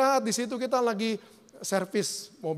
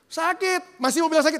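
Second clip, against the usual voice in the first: high, loud mock cry of pain; complaining body yell; 8 semitones higher, 6 dB louder than usual. Sore body answering its owner in training, high mock yell. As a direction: shout back like a sore, protesting body. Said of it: "badan saya mulai teriak-teriak".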